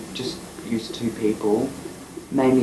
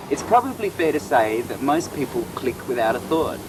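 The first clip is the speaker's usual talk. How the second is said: higher, measured narration read; documentary voice-over; 4 semitones higher than usual. Acted. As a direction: draw the viewer in like a film intro.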